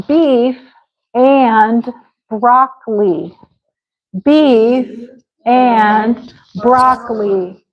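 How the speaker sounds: "Beef and broccoli" drawn out, word by word; slow modelling. Shown as a demonstration. Teacher models the dish for a student, very slowly. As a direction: say "broccoli" slowly with gaps before it.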